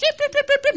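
very high, loud yelps; imitated dog yapping. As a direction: yap rapidly like excited dogs. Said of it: "and the dogs".

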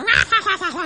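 loud mock yelling noises; imitated rowdy yelling. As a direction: roar out the noise of a brawl. Imitating people yelling and fighting.

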